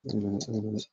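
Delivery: ordinary delivery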